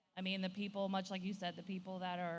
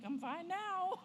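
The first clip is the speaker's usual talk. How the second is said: high, bright-sounding 'I'm fine' while upset; high-pitched pretend-okay voice; fake fine; 12 semitones higher, 4 dB louder, slower than usual. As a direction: brush it off in a high sing-song. Crying, claims to be fine.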